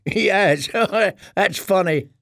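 put-on laughing boy. A narrator's reading. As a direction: laugh through it like an amused boy. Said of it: "The first boy laughed".

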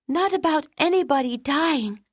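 put-on high, pleading little girl; imploring. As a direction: beg the teacher like a worried child. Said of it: "Keiko implored".

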